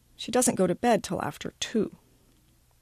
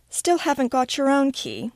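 put-on higher, pointed English accent; barbed English accent; 6 semitones higher than usual. A narrator's reading. English teacher chiding Miranda over a key, barbed English accent. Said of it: "She's English and uses her accent to barb".

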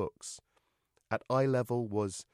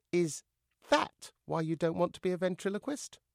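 higher voice, rising at the end; questioning dummy; 7 semitones higher than usual. Acted. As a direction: ask in a small raised puppet voice. Dummy questions Joe cautiously.

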